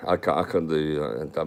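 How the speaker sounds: ordinary talk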